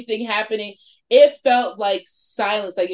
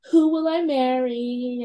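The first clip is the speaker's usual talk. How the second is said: slow, higher mock teenage question; silly teen question; 6 semitones higher, slower than usual. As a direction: playfully ham up a teenager asking the board. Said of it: "like, the silly teenage questions".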